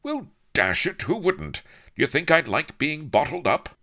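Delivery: high-pitched, indignant protest; steamy impatience, high and exasperated; impatient protest. A narrator's reading. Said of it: "he protested, all steamy impatience".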